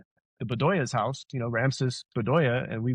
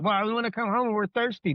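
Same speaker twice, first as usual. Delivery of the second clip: put-on higher, whining like a thirsty kid; whiny kid voice; 9 semitones higher than usual. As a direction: plead like a little kid wanting home. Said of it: "And we'd be like".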